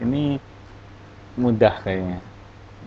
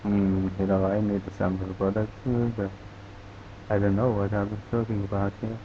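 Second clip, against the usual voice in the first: softer, swallowed mumble, words run together; mumbling; 3 semitones lower, 10 dB quieter than usual. Shown as a demonstration. Teacher shows the class garbled speech, soft mumble. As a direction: mumble it under your breath so it can't be understood. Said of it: "yang gelendeng".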